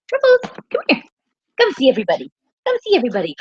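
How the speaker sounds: high, sing-song call to the dog. Real, not acted. Calling the dog back to meet everybody, high. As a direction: call her name up high to coax her.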